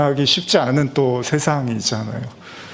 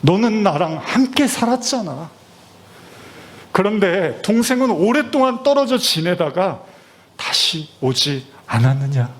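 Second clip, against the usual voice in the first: higher, earnest voice stressing 함께; father coaxing his eldest son, higher and earnest; pleading father; 7 semitones higher than usual. Acted. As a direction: plead with the elder son to understand. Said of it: "아버지는 큰아들에게 말합니다".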